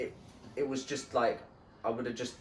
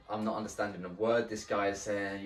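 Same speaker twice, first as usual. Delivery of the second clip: ordinary talk, nothing marked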